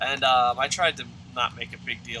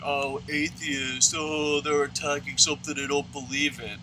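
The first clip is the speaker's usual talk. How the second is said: mocking Christians; 2 semitones higher than usual. Christians complaining about atheists, mocking imitation. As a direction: imitate a whiny objector, stretching the words. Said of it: "Christians, like".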